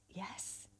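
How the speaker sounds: barely audible whisper; whispered yes. Acted. Woman answering the gorgeous stranger at the bus stop, whispering. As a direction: let it out as a tiny, tentative whisper.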